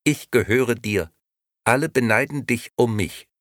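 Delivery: high, squawky doll voice; a tinny talking doll speaking up. A narrator's reading. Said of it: "Puppe ihre Lippen und sagte".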